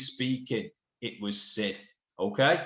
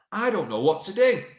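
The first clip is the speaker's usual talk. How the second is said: read aloud; 7 semitones higher, 5 dB louder than usual. Shown as a demonstration. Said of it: "comma, said Saeed".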